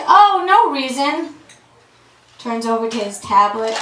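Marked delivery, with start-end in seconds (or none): 0.1-1.4 slow, higher, faux-innocent; drawn-out, sly "nothing to see here"; Muhammad hiding his plan, slow and sly; sly innocence. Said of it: "And Muhammad's like".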